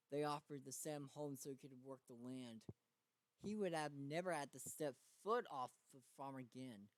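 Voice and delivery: ordinary delivery, nothing marked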